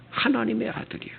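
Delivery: ordinary talk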